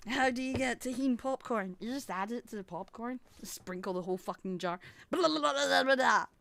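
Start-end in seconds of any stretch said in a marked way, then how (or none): none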